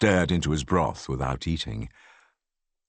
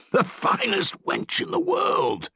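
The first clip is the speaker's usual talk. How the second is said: plain reading voice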